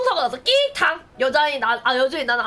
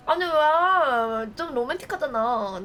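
ordinary talk

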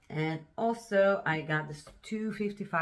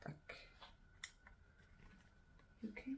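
ordinary delivery

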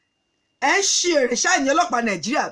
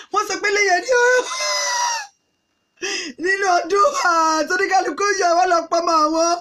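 high, raised lamenting cry; wailing crowd; 5 semitones higher than usual. Acted. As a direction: cry out in acted outrage and grief. Acted crying crowd at Christmas.

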